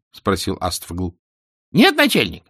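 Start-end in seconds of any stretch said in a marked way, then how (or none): 1.4-2.4 high, loud squeaky minion; distant voice. A narrator's reading. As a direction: answer the boss in a high squeak. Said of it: "Далёкий голос". (высокий писклявый голос подчинённого)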